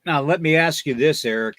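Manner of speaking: ordinary talk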